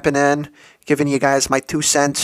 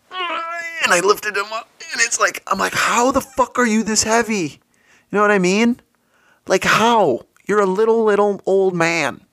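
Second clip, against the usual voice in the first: much higher, strained voice as if lifting; straining effort; 8 semitones higher than usual. Retold or imitated. Lifting the heavy old man, straining and incredulous. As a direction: squeeze it out under a heavy load.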